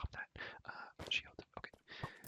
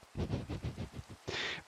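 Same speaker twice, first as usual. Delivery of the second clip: whispered laugh; 4 dB louder than usual. Real, not acted. Reader laughs at own French, in a whisper. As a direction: breath-only laugh.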